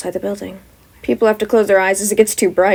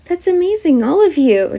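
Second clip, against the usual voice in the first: higher, pleased praise; impressed; 8 semitones higher, 4 dB louder than usual. Acted. Wizard praising the students' quirks, impressed. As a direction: praise them warmly.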